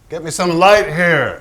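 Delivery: calling out to the crew; barked order from behind the camera; call for light. Acted. Director calls for light on set.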